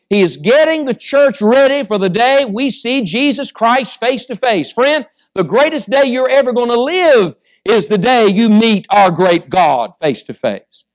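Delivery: high, driving sermon delivery; fervent preaching. Real, not acted. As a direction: preach it out to the congregation. Preaches with rising force.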